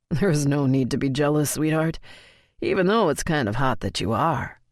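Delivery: low-key male voice, sheepish and amused; Colton, lightly voiced. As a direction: narrator slips lightly into Colton telling the story.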